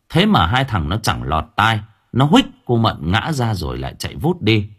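usual speaking voice

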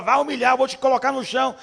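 God warns stubborn Júlio, booming; God's threat. Pretend. Preacher voicing God warning Júlio, loud and commanding.

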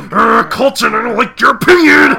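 loud, high growling outburst; hammed-up impression of a furious friend; mock anger. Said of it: "I wasn't being angry".

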